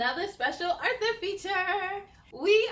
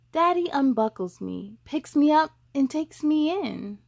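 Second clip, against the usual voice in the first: lively picture-book read, louder; reading to children; 8 dB louder than usual. Acted. Host reads a boy's story to young viewers, louder storybook voice. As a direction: read it out to the little ones, bright and full.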